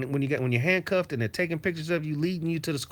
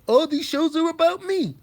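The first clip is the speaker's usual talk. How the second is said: high, loud mocking whine; mocking rival; 12 semitones higher, 4 dB louder than usual. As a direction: whine it like a crybaby. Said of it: "he's always talking about".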